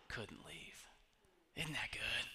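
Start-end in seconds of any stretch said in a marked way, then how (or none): 0.1-0.9 breathy whisper to close the line. Retold or imitated. Preacher quoting Larry Lee, whispered. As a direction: drop to a whisper on couldn't leave.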